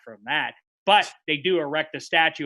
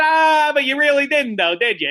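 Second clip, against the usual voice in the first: pointed challenge; 8 semitones higher, 7 dB louder than usual. Retold or imitated. Pressing Gretzky.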